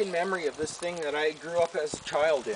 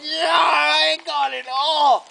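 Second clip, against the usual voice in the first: high, long-drawn victory cry; retold triumphant yell; 8 semitones higher, 4 dB louder, much slower than usual. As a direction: act out crowing over what you saved. Reenacts triumph at saving the stuff.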